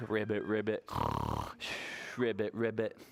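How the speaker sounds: low, drowsy frog croak; sleeping frogs. Acted. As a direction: ribbit as if fast asleep. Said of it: "pretend to be asleep".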